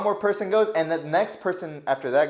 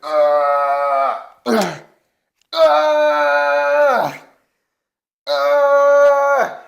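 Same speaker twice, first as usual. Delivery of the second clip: imitated straining grunts; 9 semitones higher, 9 dB louder, much slower than usual. Friend straining to lift himself.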